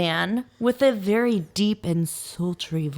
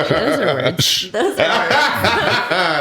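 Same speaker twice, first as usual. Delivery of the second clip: slow, said through laughter; laughing; 3 semitones lower, 4 dB louder, much slower than usual. Real, not acted. Laughing at own stumble.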